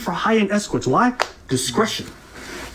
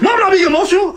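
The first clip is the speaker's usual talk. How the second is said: high, loud mock screech of protest; shrill-pitched imitation of an emotional outburst; retold screaming; 12 semitones higher, 5 dB louder, faster than usual. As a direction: imitate someone screaming in denial. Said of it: "why are you screaming like this".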